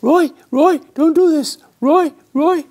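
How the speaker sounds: high, loud frantic pleading; the wife calling out in alarm, begging him to stop. Retold or imitated. Wife begs husband to back off, high and frantic. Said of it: "My wife is saying".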